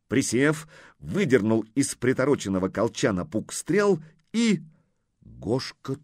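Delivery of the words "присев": ordinary delivery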